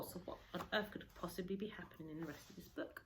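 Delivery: ordinary delivery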